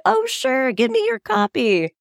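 very high, overly bright "sure"; sweetly accept, pitch shooting up; fake-cheerful high voice. Accepting the couple's copy.

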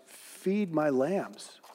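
slow and soft, very tender; gentle reassurance. A narrator's reading. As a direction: lay the words down slowly like a kind shepherd. Jesus gently answers Peter.

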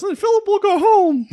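loud, very high hammed-up cry; comic high-pitched exclamation breaking toward laughter; mock triumphant yell. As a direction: shout it gleefully, pitch way up. Mock plan, exploding at the end.

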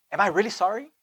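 usual speaking voice

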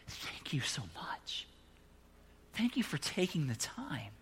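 whispered, grateful thanks; whispered gratitude; soft thanks. Retold or imitated. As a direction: hush it like a moved man. Grateful man voiced softly.

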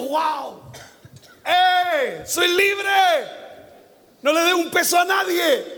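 elated yelling servant; joyful shout. Acted. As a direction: shout it out like a man just set free. Freed servant shouting for joy.

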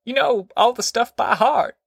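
high-pitched, pushy granny voice; insistent grandma. A narrator's reading. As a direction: insist in a high old-woman voice. Grandma keeps pressing the preacher.

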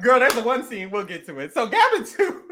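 ordinary delivery

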